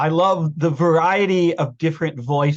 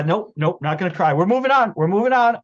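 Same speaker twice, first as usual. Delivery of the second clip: ordinary talk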